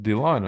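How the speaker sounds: DYLAN said as "dih-LAHN", falling; imitated Dylan ending in open AHN; unusual name pronunciation. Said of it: "how you pronounce your name".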